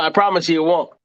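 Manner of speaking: ordinary delivery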